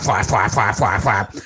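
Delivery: imitated flaps in quick repeated beats; short repeated sound-effect words; flapping noise. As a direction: mimic a slapping flap, beat after beat. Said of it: "All I hear is a flap".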